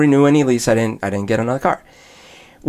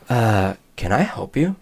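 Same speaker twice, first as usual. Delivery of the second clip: slow, lower, bored salesman brush-off; total attitude; 4 semitones lower, 4 dB quieter, slower than usual. Retold or imitated. Salesman greeting a customer with open disdain. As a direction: make the customer feel unwelcome. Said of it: "Like, with a total attitude".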